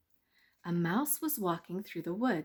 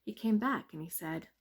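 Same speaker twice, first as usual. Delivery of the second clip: ordinary delivery, nothing marked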